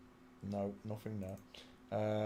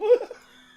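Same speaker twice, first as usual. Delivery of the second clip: shrieking laugh, much higher and louder; high laugh burst; 15 dB louder than usual. Real, not acted. Amused by a strange name, bursting into high laughter.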